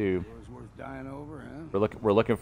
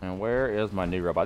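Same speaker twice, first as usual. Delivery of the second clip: usual speaking voice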